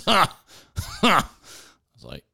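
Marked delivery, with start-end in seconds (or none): 0.1-1.3 higher, sharp mocking "Ha! Ha!"; mock laugh. Gun buyer laughing at the clerk, mocking. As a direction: bark out a scornful fake laugh. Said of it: "He goes".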